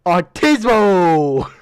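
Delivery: long drawn-out mock scream; mocking holler, held long; retold scream. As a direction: belt it out, stretching the word slowly. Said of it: "at the top of my lungs, I scream".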